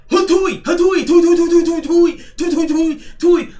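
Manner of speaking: high, loud cartoon spitting sounds; acted-out attack noises. Acting out Venom's attack for viewers, high loud spitting noises. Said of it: "I'm gonna act it out for you".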